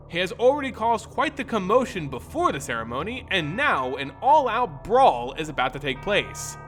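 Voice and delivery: usual speaking voice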